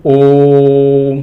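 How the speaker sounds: ओम held long and steady; prolonged Om. Shown as a demonstration. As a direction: chant the ओम long. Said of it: "ओम में ही ले लीजिए". (ओम को लंबा खींचकर)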